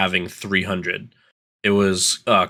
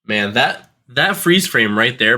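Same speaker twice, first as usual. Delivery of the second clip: normal talking voice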